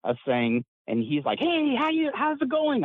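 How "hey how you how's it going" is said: high, cheery greeting; happy Jack Armstrong. Retold or imitated. An old friend greeting him on the phone, high and cheery. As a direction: chirp it like the happiest man alive. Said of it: "this is the happiest person".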